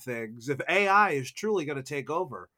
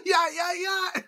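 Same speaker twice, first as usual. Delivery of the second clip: laughing. Real, not acted. Cry laugh then shout.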